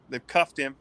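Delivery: ordinary talk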